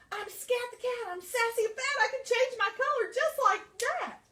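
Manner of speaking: high, loud panicked rhyme; hurried rhyme. Acted. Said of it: "very quickly".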